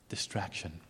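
soft whispered emphasis; whispered key word. Real, not acted. Preacher whispers the root cause to the congregation. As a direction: breathe the word to drive it home.

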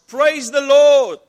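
long, slow, very loud high praise; joyful shout. Acted. Old lady shouting praise from her porch, very loud and slow. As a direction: belt it out to the whole street. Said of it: "comes out onto the front porch and shouts".

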